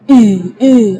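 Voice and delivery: loud, forced "i" with heavy chest breath. Shown as a demonstration. Teacher imitates wrong readers, ha forced from chest. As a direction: heave the h after "i" out of the chest. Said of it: "itu keluarnya dari dada".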